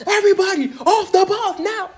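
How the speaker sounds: high mock drill sergeant yell; yelling at top of lungs. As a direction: yell the order across the bus. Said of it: "yelling at the top of her lungs".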